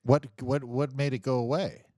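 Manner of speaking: usual speaking voice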